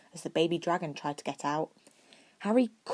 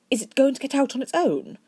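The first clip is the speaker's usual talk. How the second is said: anxious; 9 semitones higher, 6 dB louder than usual. A narrator's reading. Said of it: "Draco asked anxiously".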